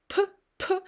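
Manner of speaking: a light puff of air on p, p with lips pressed together; teacher shows the weak airflow on p, p; soft Russian p demo. Shown as a demonstration. Teacher to students, a weak-airflow p demo. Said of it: "pretty weak airflow, which goes through your lips".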